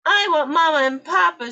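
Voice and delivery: high, loud crying wail. Acted. Lost penguin chick cries for her parents, loud wail. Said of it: "she wailed".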